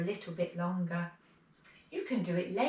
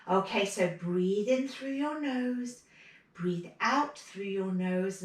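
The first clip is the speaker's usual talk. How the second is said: relaxation instruction; 3 semitones higher, 5 dB louder than usual. Acted. Guides relaxation breathing.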